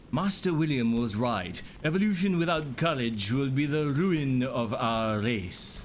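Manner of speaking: hammy put-on English accent, slowed down; clumsy stab at a posh English line; bad English accent. Streamer reading game dialogue in a bad English accent. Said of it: "Worst English accent ever".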